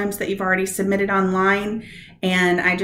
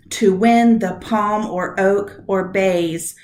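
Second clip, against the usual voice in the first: slow scansion, punching WIN, PALM, OAK, BAYS; four stressed beats; slower than usual. Shown as a demonstration. Teacher showing students four beats, slow marked iambic stress. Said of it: "four stressed beats".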